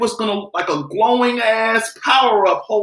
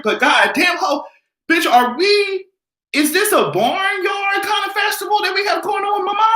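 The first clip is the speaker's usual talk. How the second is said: outraged shout; 10 semitones higher than usual. Acted. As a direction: yell it like the whole room should hear. Ranting about barn purse.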